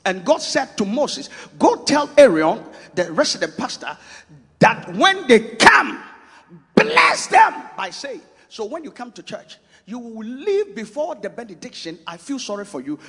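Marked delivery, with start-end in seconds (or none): 1.6-8.2 voicing God's command. Preacher voicing God's command to Moses. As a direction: proclaim the command from above.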